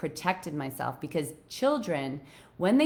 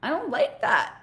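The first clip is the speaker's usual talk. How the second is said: high protest; 9 semitones higher than usual. Retold or imitated. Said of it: "Like, I'm not".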